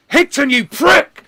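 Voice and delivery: yelled high and loud, wrestler style; mock wrestler yell. McMania yelling at Higton in the toilet, mock bellow. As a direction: bellow the insult like a furious wrestler.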